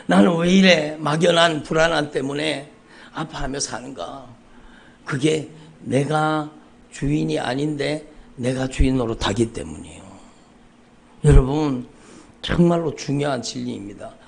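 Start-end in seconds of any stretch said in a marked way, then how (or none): none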